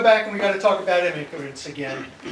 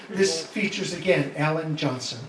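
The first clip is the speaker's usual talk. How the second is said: ordinary talk, nothing marked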